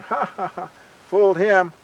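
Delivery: mock triumphant laughter; gloating laugh. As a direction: gloat over a successful trick. Swa gloats after tricking Skunk.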